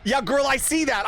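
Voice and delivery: usual speaking voice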